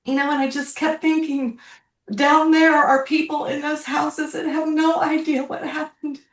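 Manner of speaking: emotional, fighting tears; close to tears. Real, not acted. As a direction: hold back real tears while telling it. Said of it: "I'm sorry if I get emotional".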